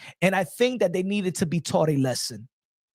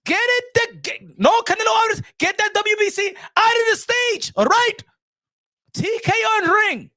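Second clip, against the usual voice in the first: high, loud hammed-up shouting order; mock Turkey Al Sheikh; 11 semitones higher, 3 dB louder than usual. Turkey Al Sheikh ordering the WBC off his stage, high and loud. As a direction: bark him off the stage. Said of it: "Turkey Al Sheikh".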